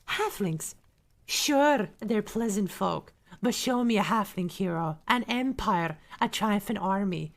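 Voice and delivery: emphatic Scottish-sounding dwarf; amateur Dwarven brogue, punched stresses; Dwarven accent. Acted. Streamer reads a dwarf's quotes on elves and halflings, in Dwarven accent. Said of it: "in my best Dwarven accent".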